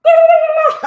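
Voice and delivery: loud cackle at the memory; loud high laugh. Real, not acted. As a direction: burst of shrieking laughter. Laughing at her own cheering.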